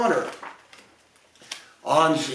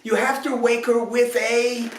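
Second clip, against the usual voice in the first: higher fairy voice, shaking heads; fairies correcting; 7 semitones higher than usual. A narrator's reading. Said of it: "They shook their heads".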